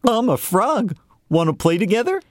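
high, eager little frog; lifted, friendly frog invitation; young frog voice. Acted. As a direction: bounce it out like a happy frog.

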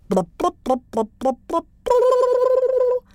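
bubbly blubs rising to a high loud squeal; babble, then burst up high; the brook answering Yamo; babbling brook. A narrator's reading. The brook speaks.

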